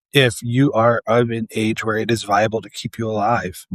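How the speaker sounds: ordinary delivery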